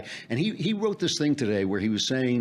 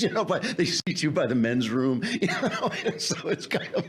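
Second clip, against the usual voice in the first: laughing while talking; 6 semitones higher than usual. Real, not acted. Laughing at a joke.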